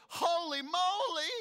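very high, loud, slow shriek; squealing Naomi. Retold or imitated. Naomi squeals with delight at Ruth's news, very high and loud. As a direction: squeal it like a giddy matchmaker. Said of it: "She goes".

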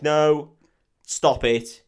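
loud retold protest, punched out; mock protesting shout. Anna stopping Van Helsing shooting her brother, mock protesting shout. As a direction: call out a halt, acting it.

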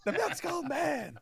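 slow, high put-on voice; high mock quote voice. Friend repeating the quote, slow high put-on voice. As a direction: echo the line in a silly voice.

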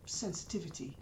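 ordinary delivery

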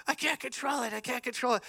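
high, whining imitation of panic; mock helpless witch. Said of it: "she's like".

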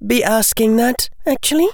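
put-on high girlish voice for Hermione; young girl's voice. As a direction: play the bright, bold schoolgirl.

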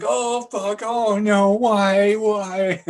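hammed-up high, loud wail; crying. Kurt Russell's character gets hurt, crying. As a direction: imitate a big baby bawling in pain. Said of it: "he's just crying".

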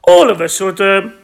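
usual speaking voice

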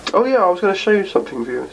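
usual speaking voice

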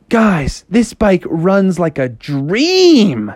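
high, loud call, slowing on the end; cartoon kid delight; thrilled Froggy. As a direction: crow it, stretching "dream".

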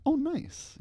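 high-pitched pleased little reaction; mock pleasant surprise in a high voice. Acting out first pleased reaction.

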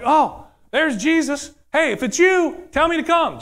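high-pitched, excited calling out; eager Peter. Acted. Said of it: "So here's Peter".

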